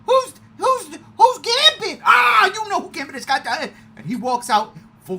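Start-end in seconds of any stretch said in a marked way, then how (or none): 0.1-2.0 high loud baffled what; high mock confusion; wife's confused shout. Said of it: "She goes". 2.1-3.7 high loud exasperation; high exasperated shout; excited scold. Retold or imitated. Answering wife excitedly.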